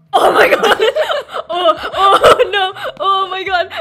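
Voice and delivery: laughing in alarm; panicked laughing. Real, not acted. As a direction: yelp and laugh at once. Drawing glitches, panicked laughing.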